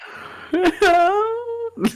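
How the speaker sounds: high mock wail; fake sob. Joking about a sickness breakdown, fake sob. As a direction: put on a crying breakdown. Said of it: "Just kidding".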